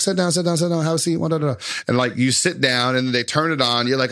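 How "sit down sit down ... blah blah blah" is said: high, eager salesman pitch; pushy salesman. Retold or imitated. Salesman coaxing a tired shopper to sit, eager and high. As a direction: lure the passerby into the chair.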